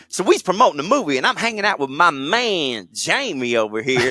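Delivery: exaggerated wannabe-cool bragging; Quentin Tarantino. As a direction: swing the pitch, trying hard to sound cool. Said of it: "I'm doing an impression of Quentin Tarantino".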